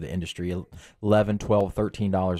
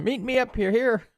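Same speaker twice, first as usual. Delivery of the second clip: high mock voice; 12 semitones higher than usual. Mocking bedroom talk.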